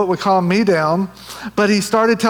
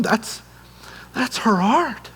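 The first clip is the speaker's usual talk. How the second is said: much softer, overwhelmed whisper-like realization; awestruck hush; 4 semitones higher, 5 dB quieter, slower than usual. Retold or imitated. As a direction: say it as it suddenly hits you. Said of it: "something just hit me".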